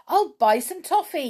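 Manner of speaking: high, loud storybook exclamation; cried out. A narrator's reading. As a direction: call it out with sudden eagerness. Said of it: "he cried".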